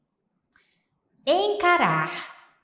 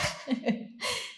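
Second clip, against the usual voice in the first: breathy laugh; 8 dB quieter than usual. Real, not acted. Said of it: "he freaks out".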